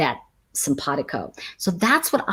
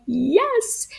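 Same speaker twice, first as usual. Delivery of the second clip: yes sung out much higher; delighted yes; 13 semitones higher than usual. Retold or imitated. To a student who found God, delighted yes. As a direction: cheer them on with a bright yes. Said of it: "And I'm like".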